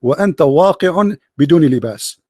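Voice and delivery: low, measured classical Arabic recitation; mock classical poetry. Shown as a demonstration. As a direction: declaim an improvised insult in formal Arabic verse. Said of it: "I just made a poetry". (إلقاء شعر هجائي بالفصحى)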